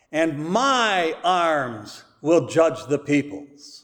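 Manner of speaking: slow scripture reading, every My stretched out; reading with emphasis. Shown as a demonstration. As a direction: lean long on each My, then pause. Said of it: "I am going to read it with emphasis".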